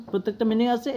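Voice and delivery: ordinary talk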